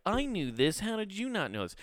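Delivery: high mocking voice of a smug influencer. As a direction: sneer it like a know-it-all. Mocking smug video maker.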